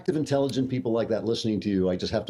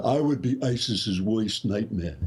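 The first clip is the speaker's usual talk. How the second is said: mock tough boast; slower than usual. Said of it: "the me stuff".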